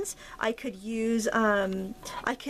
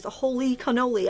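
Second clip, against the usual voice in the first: ordinary delivery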